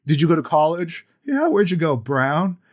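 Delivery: chef's casual quiz. Retold or imitated. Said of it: "And he's like".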